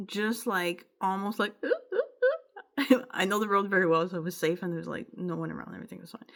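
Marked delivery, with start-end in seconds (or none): 1.0-3.1 tearful laugh breaking much higher; half laughing, half sobbing; choked laugh-cry remembering the falcon. Real, not acted.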